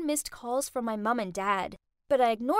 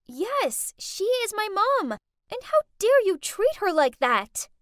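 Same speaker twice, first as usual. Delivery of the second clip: high, louder defiant retort; indignant; 7 semitones higher, 6 dB louder than usual. A narrator's reading. Daughter defending her mom to rich friends, indignant. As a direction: stand up to them, building in volume.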